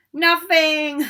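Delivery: loud laughing taunt; gleeful cackling. A narrator's reading. Peeves cackling at the caught students. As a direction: laugh it out at them.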